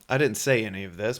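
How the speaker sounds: ordinary talk, nothing marked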